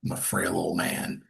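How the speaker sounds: hushed, pitiful little plea; whispered mock-frail plea for help; self-deprecating whisper. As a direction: shrink down and beg for a spot in a whisper. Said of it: "self-deprecating".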